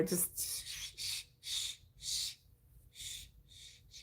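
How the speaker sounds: soft whispered shh, over and over; shushing. Retold or imitated. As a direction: hush someone like a baby. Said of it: "I get shushed".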